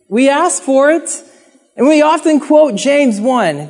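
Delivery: ordinary delivery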